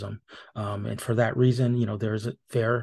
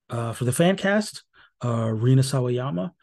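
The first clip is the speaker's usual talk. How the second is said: ordinary talk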